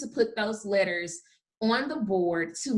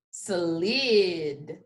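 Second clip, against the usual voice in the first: slid stretched, s-l-i-d; blended word; 5 semitones lower than usual. Shown as a demonstration. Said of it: "I'm gonna sound it out".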